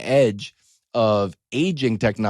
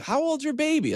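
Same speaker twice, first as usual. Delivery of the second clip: much higher, gushing parent asking; mock parent voice; 3 dB quieter than usual. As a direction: play a cooing parent asking about a baby. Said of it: "They're like".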